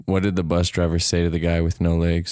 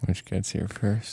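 holding back a laugh, slow and soft; barely keeping a straight face; stifled giggles; 2 semitones higher, 7 dB quieter, slower than usual. Real, not acted. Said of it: "funnier when you're trying not to laugh".

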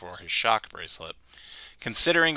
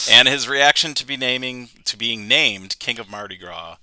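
ordinary talk

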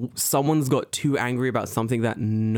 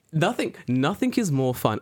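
ordinary delivery

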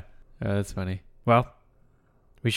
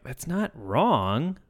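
slow, higher, wavering admission; hesitant concession; 4 semitones higher, 4 dB quieter, much slower than usual. Acted. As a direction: stretch it out as if reluctantly conceding. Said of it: "it's funny 'cause you're like".

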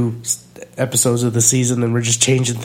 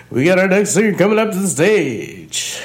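bar announcer; 7 semitones higher than usual. Retold or imitated. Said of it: "I was doing that, like, all last night".

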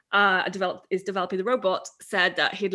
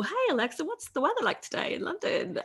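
polite full question; 7 semitones higher, 5 dB quieter than usual. Shown as a demonstration. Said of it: "having to go".

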